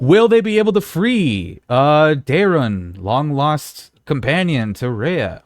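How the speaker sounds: slow, high dramatic cliffhanger voiceover; TV announcer voice. Pretend. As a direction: build the suspense like a show announcer. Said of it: "tune in until after the commercial break".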